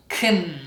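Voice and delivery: KUN with no vowel sound; k straight into a held n in KUN; reduced can. Shown as a demonstration. Teacher repeats for learners, reduced can without vowel. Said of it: "No vowel sound".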